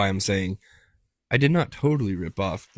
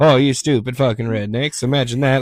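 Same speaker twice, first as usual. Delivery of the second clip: louder mocking jab; mock sneering listener; 3 semitones higher, 7 dB louder than usual. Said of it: "being like".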